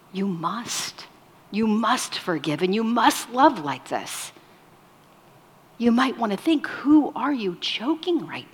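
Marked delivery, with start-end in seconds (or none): none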